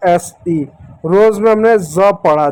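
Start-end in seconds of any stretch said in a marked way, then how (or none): 1.8-2.1 "ज" said as za, like English Z; z sound. Shown as a demonstration. Teacher reading S in rose, "ज" as za. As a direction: buzz the start of "ज". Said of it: "एस को जेड के जैसा".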